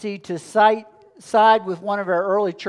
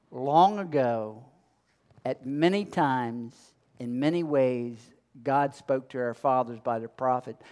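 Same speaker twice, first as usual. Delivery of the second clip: scripture reading; 6 semitones lower, 6 dB quieter than usual. Acted. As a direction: read the verse softly and solemnly, unhurried. Reads the scripture verse.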